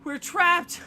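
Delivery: high, loud frightened cry; panicked cry. Acted. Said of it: "you cry".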